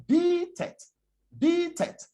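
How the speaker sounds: "Beat it" with long EE in "Beat", short "it"; high drawn-out "Beat", then a brief "it". Shown as a demonstration. Coach showing how the singer says it, long-vowelled "Beat it". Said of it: "The E, the, the sound".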